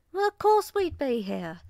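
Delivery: high, loud Cockney voice; Cockney accent. Acted. Giraffe greeting her hostess at the door, Cockney accent. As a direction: belt it out in Cockney. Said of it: "Giselle said in her Cockney accent".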